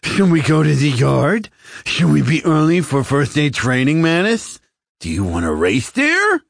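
high, loud, bubbly alien voice; enthusiastic alien. A narrator's reading. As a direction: overeager creature gushing at his bunkmate. Klimo again, loud and eager.

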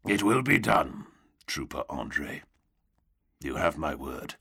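low, grim drawl. A narrator's reading. Knight promising the trooper, low grim drawl. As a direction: give your word in a bleak low drawl. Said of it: "a low and bleak and grim drawl".

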